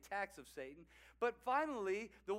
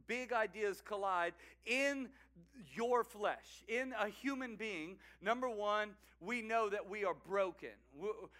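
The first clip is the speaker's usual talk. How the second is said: ordinary delivery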